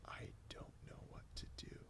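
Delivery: barely voiced, hopeless whisper; exhausted whispered confession; despairing whisper. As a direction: breathe it out as if giving up. Despairing in the drive-thru.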